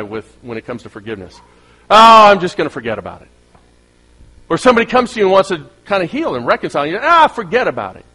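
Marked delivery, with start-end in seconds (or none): 1.9-3.3 dismissive brush-off. Retold or imitated. Mocking a dismissive forgetter. 7.0-8.0 dismissive wave-off. Retold or imitated. Mock dismissive reply.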